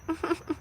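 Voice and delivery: high, quiet fake giggle; a prissy little laugh to start the mockery; mocking giggle. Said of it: "busy trying to be like".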